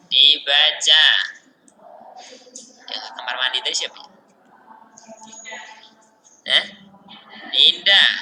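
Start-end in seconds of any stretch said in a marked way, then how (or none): 2.9-4.1 whispered aside. Real, not acted. Aside question during class.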